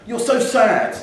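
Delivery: put-on high, loud jeering kid; mock his mate loudly; a schoolboy sneering at his friend's bad play; taunting schoolboy.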